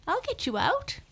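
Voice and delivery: very high, squeaky mouse voice; mouse crying out. A narrator's reading. Mouse promising to free the trapped lion, crying out. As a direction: call out in a tiny squeal. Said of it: "cried Mouse".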